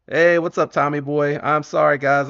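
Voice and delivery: high, loud, lively hello; loud greeting. Real, not acted. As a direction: call out brightly to an arriving viewer. Greeting a viewer on livestream.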